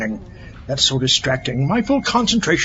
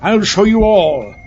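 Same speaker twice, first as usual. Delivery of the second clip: loud, higher, slow villain vow; villainous outburst; 5 semitones higher, 5 dB louder, slower than usual. Acted. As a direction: fling it out to the world.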